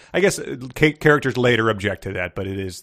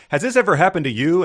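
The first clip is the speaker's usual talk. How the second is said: infomercial pitch; 7 semitones higher, 4 dB louder than usual. Pretend.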